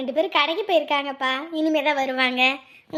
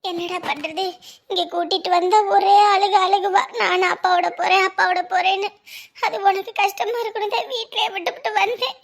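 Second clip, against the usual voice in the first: raised, tear-choked voice; tearful crying; 5 semitones higher, 4 dB louder than usual. Acted. Mother explaining to departing son why she left the child home, crying. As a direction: wail it out, higher and louder. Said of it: "நீ அழக்கூடாது".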